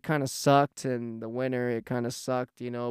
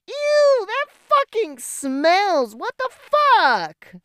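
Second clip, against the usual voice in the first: much higher and louder, slow and disgusted; grossed-out outburst; 7 dB louder, much slower than usual. Retold or imitated. Smelling his shirt, he squeals in disgust at the stink. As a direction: squeal of revulsion at the reek. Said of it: "the same reaction".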